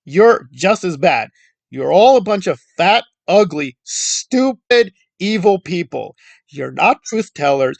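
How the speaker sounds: ordinary talk, nothing marked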